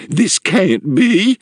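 high, slow stammering matron; aghast woman, lost for words; shocked disbelief. A narrator's reading. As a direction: falter in high-pitched disbelief. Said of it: "her big horse eyes plainly shocked".

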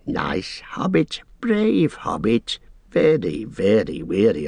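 wheedling creature voice; fawning, hissing and sly; Gollum voice. Acted. Gollum flatters and urges.

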